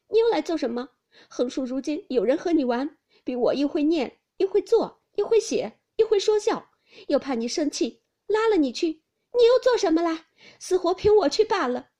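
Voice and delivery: high, loud, aggrieved tirade; jealous outburst at full pitch; hurt, angry reproach. A narrator's reading. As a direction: pile up the grievances one by one. Tearful Daiyu reproaches Baoyu.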